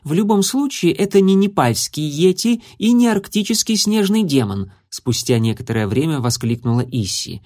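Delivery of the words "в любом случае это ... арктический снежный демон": lightly voiced young girl; higher, lively girl's voice. A narrator's reading. Issy, a young girl character.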